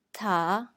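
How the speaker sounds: "Ta" said low and dipping, third tone; tone three ta. Shown as a demonstration. Teacher models Mandarin third tone on ta, dipping. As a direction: drop "Ta" low like the uh-huh dip. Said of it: "examples of the words in tone three".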